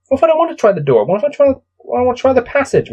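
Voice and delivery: high, indignant objection to the book; mock protest. Real, not acted. Player objecting to the book's options, high mock protest. Arguing with the gamebook's choices.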